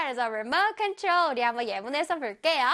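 ordinary talk

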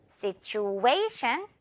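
Situation with A punched, stretched very slowly; stress on A. Shown as a demonstration. Teacher drilling stress on A, very slow. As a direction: hammer the A and drag it. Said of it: "A 부분에 강하게 갑니다".

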